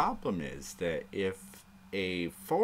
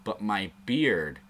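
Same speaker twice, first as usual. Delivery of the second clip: ordinary talk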